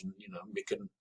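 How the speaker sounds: usual speaking voice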